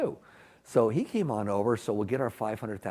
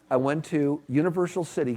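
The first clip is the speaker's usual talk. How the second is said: ordinary delivery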